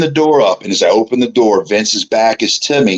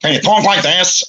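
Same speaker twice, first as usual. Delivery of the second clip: high-pitched, put-on boss impression; Vince McMahon; 8 semitones higher than usual. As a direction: demonstrate a funny voice as the boss. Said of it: "Vince goes".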